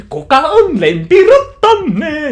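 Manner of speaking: very high, loud, slow sing-song call; mascot host. Retold or imitated. Mascot calling out to the kids, very high, loud and slow. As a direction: ham it up like a theme park mascot. Mascot greets the crowd again.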